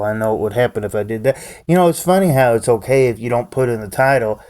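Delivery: normal talking voice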